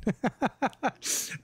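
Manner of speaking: high, staccato laughter; a run of quick high chuckles. Real, not acted. Amused by a chat joke.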